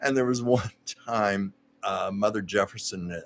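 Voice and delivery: ordinary delivery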